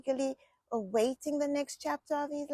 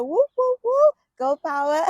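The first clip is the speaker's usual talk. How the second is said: high, loud celebratory whooping; loud, high-pitched cheer; excited cheer; 6 semitones higher, 10 dB louder than usual. Real, not acted. Cheering news of baby girl.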